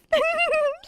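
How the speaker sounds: high, breathy-free nervous giggle; demo giggling. Shown as a demonstration. Demonstrating the giggler, high nervous giggle. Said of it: "So this is like this".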